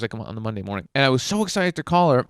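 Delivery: ordinary talk, nothing marked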